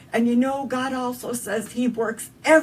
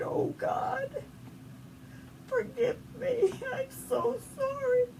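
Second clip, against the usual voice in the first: much higher, softer, tearful sobbing voice; crying plea; 9 dB quieter than usual. Retold or imitated. Mother begging God's forgiveness, weeping high and soft. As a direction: break down into a small weeping plea.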